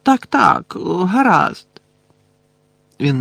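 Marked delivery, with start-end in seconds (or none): 0.0-1.7 high-pitched, offhand yes; dismissive agreement. A narrator's reading. Phineas accepting Dumbledore's errand, high dismissive agreement. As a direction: brush it off in a high voice.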